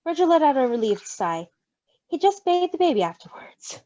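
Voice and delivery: ordinary delivery, nothing marked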